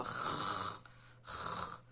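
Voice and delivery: rrr rolled deep in the throat, then breathed out; strong throat r. Shown as a demonstration. Teacher showing the class the strong R sound. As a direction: growl the rrr low from the throat. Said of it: "que parece que a gente faz na garganta".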